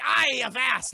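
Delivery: a loud, awkward stab at a pirate bark; clumsy pirate try. Retold or imitated. Will trying to sound like a pirate in front of the crew, loud and clumsy. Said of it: "trying to act like a pirate".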